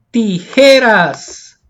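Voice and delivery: tijeras very slowly, with a long loud JE; stress on second-last syllable. Shown as a demonstration. Teacher showing pupils where the force falls, stressed JE. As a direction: stretch and punch JE in tijeras. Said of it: "dónde lleva la mayor fuerza de voz".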